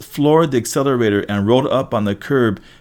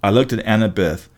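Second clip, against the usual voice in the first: ordinary delivery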